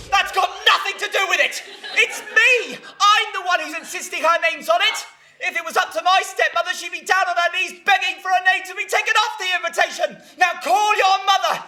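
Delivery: high, shouted insistence; furious argument. Acted. Fiancee fighting over the stepmother's name, furious and high-pitched. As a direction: argue back at full tilt.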